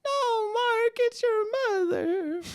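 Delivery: very high, slow wailing ghost voice; ghost mother. Acted. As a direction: moan like a pitiful ghost mom.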